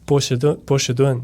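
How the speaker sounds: 波士顿 echoed twice in careful Mandarin, bō shì dùn; repeating Chinese word. Shown as a demonstration. Learner echoes the Chinese word for Boston, practising its sounds. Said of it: "嗯，波士顿。".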